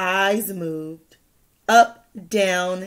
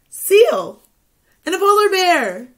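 excited; 9 semitones higher, 7 dB louder than usual. Real, not acted. Excited listing zoo animals.

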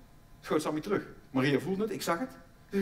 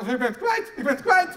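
mock panicked shout; 6 semitones higher, 6 dB louder, slower than usual. Voiced staff shouting in delivery room.

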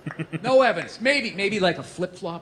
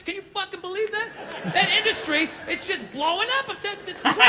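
higher-pitched, marveling regular guy; mock amazement; 8 semitones higher than usual. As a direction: play a guy gushing over good news. Acted amazed worker chatting.

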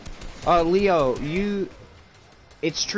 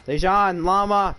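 raised, higher call; 7 semitones higher, 5 dB louder, slower than usual. Real, not acted. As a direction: shout his name across. Calling out to teammate.

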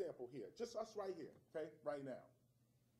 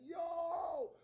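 high, loud cheering shout; crowd cheer; 11 semitones higher, 10 dB louder than usual. Retold or imitated. Six thousand French fans cheering, loud and high.

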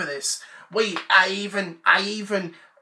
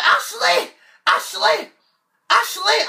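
calling out; 10 semitones higher than usual. Acted. Brother yelling for his sister Ashley to come up. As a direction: yell for your sister to come.

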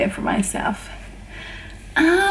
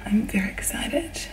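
hushed, softer whisper; excited whisper; 4 semitones lower, 8 dB quieter than usual. Real, not acted. Whispering to viewers so people can sleep. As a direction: whisper it to keep from waking people. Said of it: "I'm trying not to talk too loud".

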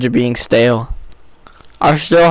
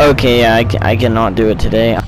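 usual speaking voice

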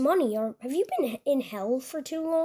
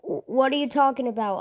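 ordinary delivery

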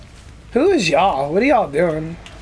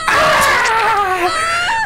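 sudden high shriek, put on; mock high scream. Streamer faking the survivor's scream, high shriek. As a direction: screech like a scared victim.

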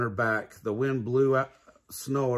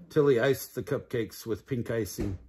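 usual speaking voice

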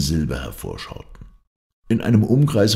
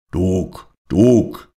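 rising shout, louder on the repeat; 5 dB louder than usual. A narrator's reading. Captain calls his dog down in the fog, shouting. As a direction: call the dog, building in pitch and force. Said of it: "schrie der Kapitän".

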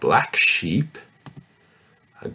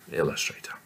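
a soft whisper of the word; whispered word for the children to copy; 6 semitones lower, 8 dB quieter, much faster than usual. Shown as a demonstration. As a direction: breathe it out, no voice. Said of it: "whispered repeat of the word".

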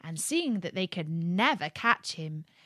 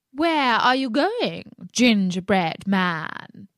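slow, loud, sharp call; sharp fox voice; 6 dB louder, slower than usual. Acted. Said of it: "called out in a sharp voice".

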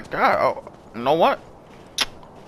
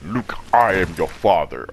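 low, slow Vader rumble; deep, slow mock Vader line; Darth Vader impression; 6 semitones lower, slower than usual. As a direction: intone it like a masked villain in the dark. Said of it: "like he was fucking Darth Vader".

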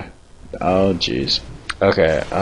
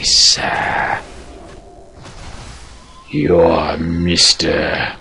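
put-on dark character; 2 semitones lower, much slower than usual. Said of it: "I just like speaking in my voices".

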